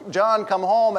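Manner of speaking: usual speaking voice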